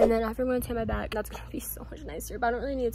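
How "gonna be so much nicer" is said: breathy whispered aside, voice dropped right down. Real, not acted. To her viewers about tanning her back, whispered. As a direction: hush it like a little secret. Tanning chat, sudden hush.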